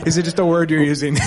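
giggling through the question; laughing question. Real, not acted. Audience member laughing at the host's question. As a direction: laugh while asking, half embarrassed.

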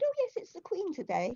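lightly mimicked, high and breezy; imitating an offhand palace attendant; casual palace lady. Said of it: "she just very casually said".

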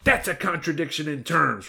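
high-pitched mock-indignant comeback; sharp retort. A narrator's reading. Girl boss rejects the wolf's defence, retorting. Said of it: "retorted the girl boss".

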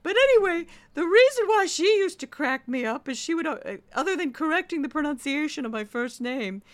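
high, loud theatrical teacher; imitated voice teacher. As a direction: narrate in the teacher's projected, exaggerated voice. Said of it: "Her voice sounded like this".

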